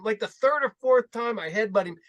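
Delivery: ordinary talk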